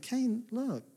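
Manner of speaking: soft, tender voice of a caring father; softly voiced loving God asking his son; gentle loving God. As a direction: coax him gently, almost hushed. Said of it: "God was loving and gentle".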